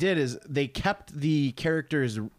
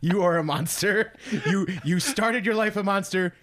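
mock outrage; 6 semitones higher than usual. Friend scolding friend over the scene, in hammed-up mock fury.

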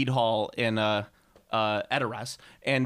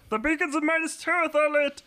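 imitating Aragorn. Retelling the film scene, a high heroic shout. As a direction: bellow it like Aragorn. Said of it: "he's like".

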